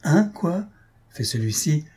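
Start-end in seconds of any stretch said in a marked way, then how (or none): 0.0-0.7 higher-pitched, startled question; sursaut outré. A narrator's reading. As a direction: react as if jumping up in outrage. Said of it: "en bondissant comme un tigre". (sursaut indigné, voix aiguë)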